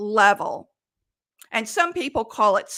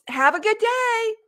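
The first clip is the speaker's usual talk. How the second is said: singsong, much higher corny goodbye; corny sign-off; 10 semitones higher than usual. Shown as a demonstration. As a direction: overdo the sweet farewell. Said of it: "It just sounds corny".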